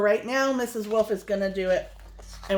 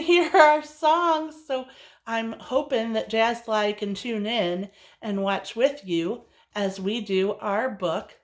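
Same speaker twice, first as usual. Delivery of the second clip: ordinary delivery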